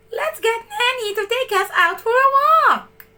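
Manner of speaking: high, bright little pup suggesting an outing; excited puppy pitching an idea; eager puppy voice. A narrator's reading. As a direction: voice Lucky high and keen. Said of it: "Lucky suggested".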